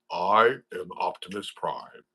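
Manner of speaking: slow, deep robot-hero impression; Optimus Prime. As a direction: intone it slowly like a heroic leader. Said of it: "first introduced to".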